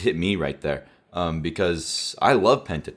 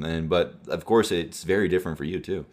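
normal talking voice

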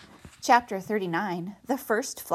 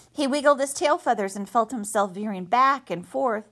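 plain reading voice

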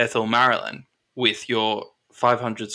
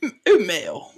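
lightly acted colonel, pitched high; colonel's approval; 12 semitones higher than usual. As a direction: praise an acquaintance in a high character voice. Colonel reacts to baker's name.

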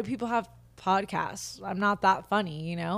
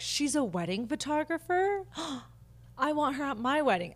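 high, gasping mock delight; hammed-up excited gasp; excited bride; 7 semitones higher than usual. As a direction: play a thrilled bride who just found her photographer. Imagined bride discovers her work.

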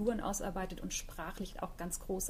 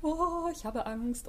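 higher, drawn-out mock-fearful wail; play-acted fear, pitched up and stretched out; anxious inner voice; 6 semitones higher, slower than usual. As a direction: slowly voice a worried inner doubt. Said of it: "da gibt's eine Stimme".

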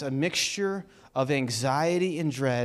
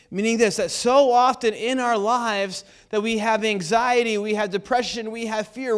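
higher, louder preaching voice; impassioned sermon delivery, pitch raised; 7 semitones higher, 6 dB louder than usual. Acted. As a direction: build the list like a fired-up preacher. Preaching about anxiety and bills.

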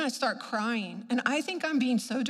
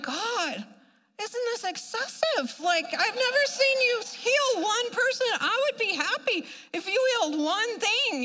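high, emphatic mock whine; pleading complaint; 9 semitones higher than usual. Churchgoer bargaining with God, hammed-up exasperated protest.

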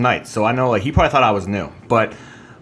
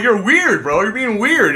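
mock scolding; 8 semitones higher, slower than usual. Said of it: "I'm like".

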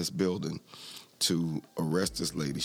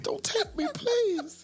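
very high falsetto plea; hammed-up begging, pitched way up; imitating begging lady; much slower than usual. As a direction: beg in a put-on high woman's voice. Retells lady begging officer.

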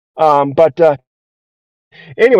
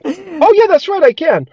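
high, brightening oh yeah; cheered up; 10 semitones higher than usual. Retold or imitated. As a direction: perk up at the good news. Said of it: "And I'm like".

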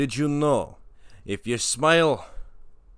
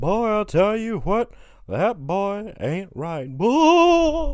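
Cotton Hill; 10 semitones higher, 5 dB louder, slower than usual. Acted. King of the Hill impression.

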